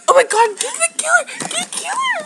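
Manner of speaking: high-pitched frantic plea; panicked urging. Real, not acted. Calling for bug killer, high panicked urging. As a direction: beg for help in a panic.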